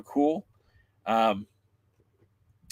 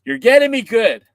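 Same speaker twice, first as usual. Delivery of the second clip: loud laughing complaint; 11 semitones higher, 10 dB louder than usual. Real, not acted. Laughing at friend's jokes.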